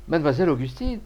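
elated, high exclamation; enthusiastic cry. A narrator's reading. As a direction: cry her name with mounting delight. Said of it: "s'écria Joseph Lebas dans son enthousiasme".

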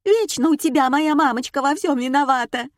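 high-pitched, aggrieved scolding; indignant wife. A narrator's reading. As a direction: scold the husband in shrill indignation. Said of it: "возмутилась жена". (возмущённый визгливый упрёк)

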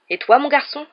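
high, loud rising question; pointed, booming interrogation; sharp challenge. A narrator's reading. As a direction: demand an answer from the boy. Uncle barks question at Harry.

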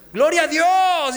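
loud, high, drawn-out shout of praise; exultant praise. Retold or imitated. Man hanging from a branch praises God, exultant shout. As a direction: shout thanks to God, stretching the words. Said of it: "Pues eso pensó".

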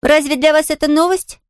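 put-on high child's question; innocent little girl. A narrator's reading. Girl wondering at the invalid's surprise, innocent. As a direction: ask artlessly in a girlish voice.

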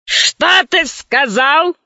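slow growled gorilla question; growling gorilla. Acted. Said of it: "зарычала она".

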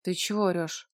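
put-on flat drunk question; expressionless. A narrator's reading. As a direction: ask with no expression. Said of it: "без выражения спросил владелец москвича".

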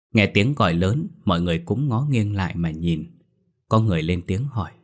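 plain reading voice

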